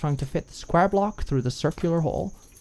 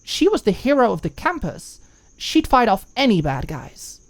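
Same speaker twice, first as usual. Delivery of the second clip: tipsy bravado; 7 semitones higher, 4 dB louder than usual. A narrator's reading. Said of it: "Didn't people know".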